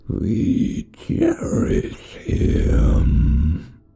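very slow, loud, half-breathless monster voice; horrible creature voices. A narrator's reading. Spider creatures cooing over the boy, horrible slow voice. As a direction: croon it like something inhuman. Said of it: "listened to the horrible voices".

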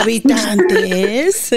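slow, drawn-out TV-host call; dramatic host announcement. Pretend. Host opening the show in a mock-grand voice. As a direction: stretch it out like a reality-show host. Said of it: "la jefa ... anda diciendo".

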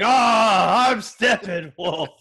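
loud, slow, drawn-out villain roar; over-the-top monster announcing himself; Steppenwolf. Acted. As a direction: bellow it like a hammy supervillain. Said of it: "even Steppenwolf's like".

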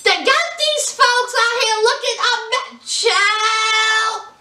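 high-pitched, drawn-out exclamation of disbelief; animated outrage. Real, not acted. Vlogger gossiping about a festival documentary, high-pitched outrage. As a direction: exclaim it way up high, stretching the last word.

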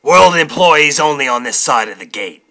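shout. Acted. Weasel ordering newsies away from the gate, shouting. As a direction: bellow an order at the crowd. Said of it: "Weasel shouted from the window".

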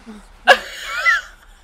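high, loud shrieking laugh. Real, not acted. Laughing after dying in the game.

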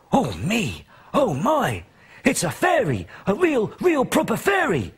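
put-on high, thrilled little boy crying out; a boy who can't believe he's found a fairy; excited cry. Little boy discovering a fairy in his bug catcher, thrilled high cry. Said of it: "cried Tyler".

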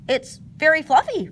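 sudden, high and loud; blurted out. Acted. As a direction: blurt it out eagerly. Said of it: "Pooh finally blurted out".

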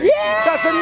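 high cheering yeah; excited shout. Real, not acted. Cheering with students.

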